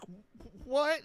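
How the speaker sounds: high-pitched stammered disbelief; mock shock. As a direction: imitate a stunned girl squeaking out a question. Retelling the girl's shocked reply.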